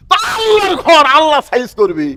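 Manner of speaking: high shouted retort to the congregation; emphatic preacher's shout. Real, not acted. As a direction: burst out at a high pitch, then let it fall. শ্রোতাদের প্রতি জোরালো ঘোষণা. (ওয়াজের চড়া গলায় হাঁক)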